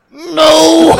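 high, loud laughing no. Retold or imitated. Telling the helpful driver no, laughing loudly. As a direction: blurt out a panicked no. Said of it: "And I go".

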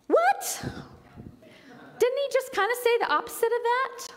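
sudden squeaky high What, then rushed on; mock disbelief. Preacher to congregation, feigning bafflement at Jesus's words, high and fast. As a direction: play up the shock at the contradiction.

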